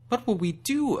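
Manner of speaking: high, loud panicked doe; frightened cry. A narrator's reading. A frightened doe crying out to Kargash. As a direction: cry out in fear. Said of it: "a doe cried".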